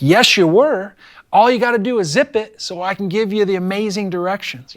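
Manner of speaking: retold teacher's scolding comeback; retold exasperated retort. Retold argument with defiant kid.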